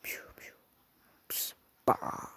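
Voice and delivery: whispered pew-pew blaster sounds; whispered gun noises. Acted. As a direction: mouth the shots in a whisper.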